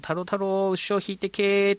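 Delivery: festival opening call. Retold or imitated. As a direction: call out the play's opening cry, lightly. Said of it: "という掛け声から始まって".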